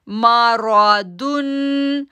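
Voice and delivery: मारादुन chanted very slowly with a heavy रा and a heavy दुन; slow tajweed chant; full-mouthed recitation. Shown as a demonstration. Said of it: "दुन को भी मोटा पढ़ेंगे".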